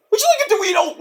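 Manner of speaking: offering snack in a high put-on voice; high skit voice. Skit character offers a Dorito in a high voice. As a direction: chirp the offer.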